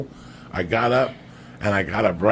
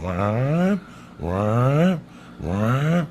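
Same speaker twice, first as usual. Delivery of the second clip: buzzer imitation; 3 semitones higher, much slower than usual. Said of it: "it's going".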